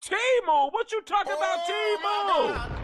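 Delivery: high, loud sneering Temu; mocking Temu shout. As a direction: jeer it out like a cruel classmate. Classmates mocking the dress source.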